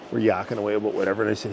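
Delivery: ordinary delivery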